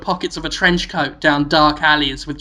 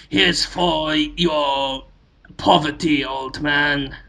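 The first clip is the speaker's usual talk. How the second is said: very slow, lofty and softer; mock condescension; 3 semitones lower, 4 dB quieter, much slower than usual. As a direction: dole it out like a smug rich man. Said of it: "throw him a penny and go".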